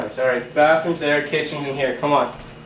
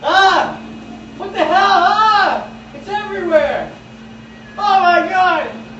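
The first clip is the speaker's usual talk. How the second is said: high panicked shrieks; panicked screaming; 4 dB louder, slower than usual. Acted. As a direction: scream in horror at the mess. Toilet overflowing everywhere.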